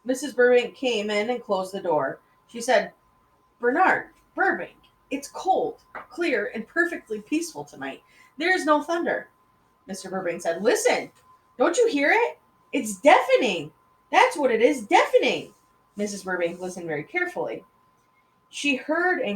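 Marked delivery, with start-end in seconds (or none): 3.6-9.3 higher, patient wife's voice; wife tells husband the night is peaceful, higher and patient. A narrator's reading. Wife calmly corrects husband. 10.6-15.5 loud and higher, insisting; husband insists to wife it is deafening, loud and high; insistent shout. A narrator's reading.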